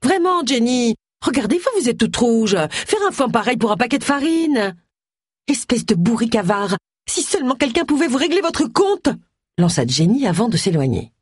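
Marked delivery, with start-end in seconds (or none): 0.0-4.8 high-pitched, fussing busybody; Gloria, condescending. Acted. Borrower belittling her angry neighbour over flour, high and chiding. 5.5-9.1 high, exasperated parting shot; angry insult. Acted. Widow insulting the stingy borrower before leaving, high and cross. Acted quarrel, Jenny insults Gloria.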